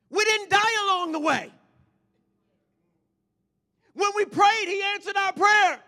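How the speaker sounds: loud, high preaching shouts; full-force preaching. Real, not acted. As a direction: drive it home to the congregation.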